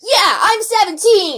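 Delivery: high, loud, slow smug claim; mock boast. Seven-year-old claiming to be seventeen, smug and high. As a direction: drawl the lie out proudly. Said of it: "He's like".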